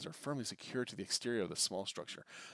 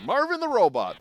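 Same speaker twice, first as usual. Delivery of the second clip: booming, much higher shout; loud announcement; 11 semitones higher, 12 dB louder, slower than usual. Real, not acted. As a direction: call the name out like a cheer. Names the robot with a cheer.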